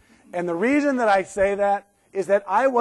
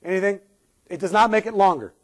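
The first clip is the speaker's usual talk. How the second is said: ordinary talk, nothing marked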